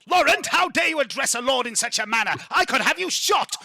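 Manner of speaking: high, loud blustering lord; mock outrage. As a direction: bellow a pompous threat in jest. Lord feigns outrage before laughing.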